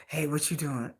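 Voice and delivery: low soft sly man's voice; low mock suitor. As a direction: drop low and flirt. Man calling again.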